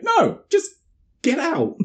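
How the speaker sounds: hammed-up high snap; mock snap. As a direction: bite it off like a mock outburst. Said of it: "And she's like".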